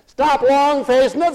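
high, loud, slow order; preached command. Real, not acted. Preacher turning the song line on the congregation, preached command. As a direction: belt it out as a command to the whole room.

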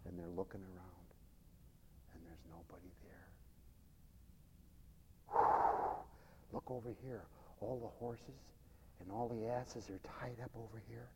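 very low, soft near-whisper; hushed storytelling. Acted. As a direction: creep through it, barely voiced. Hushed acting of the silent camp.

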